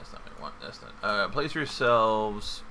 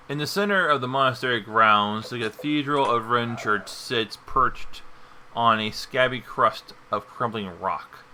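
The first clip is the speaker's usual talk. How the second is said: louder, dramatic scene setting; game master narration; 2 semitones higher, 5 dB louder than usual. Acted. GM describes cathedral scene.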